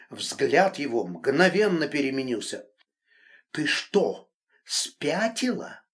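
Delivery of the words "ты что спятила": slow, calm, every word spelled out; measured, icy calm question; carefully enunciated. A narrator's reading. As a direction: say each word deliberately and evenly. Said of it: "Тщательно выговаривая каждое слово, очень спокойно".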